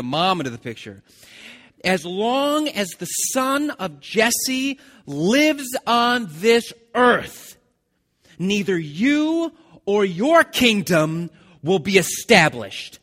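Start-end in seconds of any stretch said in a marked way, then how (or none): 1.8-13.0 very slow, much higher and louder tirade; raging King Saul accusing Jonathan; furious king. Acted. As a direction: bellow the accusation, drawing each word out. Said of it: "He's pretty mad".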